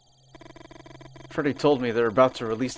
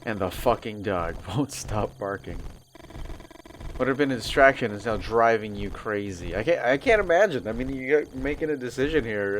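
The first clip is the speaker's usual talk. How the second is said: ordinary delivery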